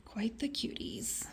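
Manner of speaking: soft unvoiced whisper. Real, not acted. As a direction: breathe it out quietly. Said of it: "whispered repeat".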